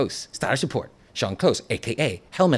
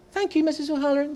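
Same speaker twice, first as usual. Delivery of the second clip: high, quick, sweet schoolboy thanks; prim good boy voice thanking his teacher; faster than usual. Pretend. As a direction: sound like the teacher's pet. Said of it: "in my good boy voice".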